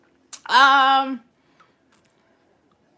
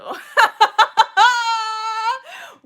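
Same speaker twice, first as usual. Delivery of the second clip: high shrieking laugh; 11 semitones higher, 7 dB louder than usual. Real, not acted. Big laugh at comment.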